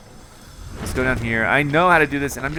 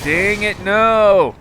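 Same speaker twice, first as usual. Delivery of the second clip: higher, rising frustrated no; frustrated cry; 8 semitones higher, 4 dB louder, much slower than usual. Retold or imitated. Player crying out at a missed jump. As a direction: cry out at the miss. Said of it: "And I'm like".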